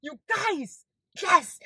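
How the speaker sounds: high, small fangirl squeak; overwhelmed squeal. Real, not acted. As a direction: squeak it out, overcome with delight. Overwhelmed by radio moment.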